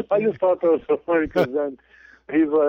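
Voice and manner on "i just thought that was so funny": ordinary delivery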